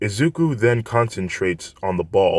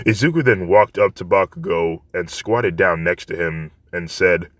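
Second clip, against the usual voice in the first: plain reading voice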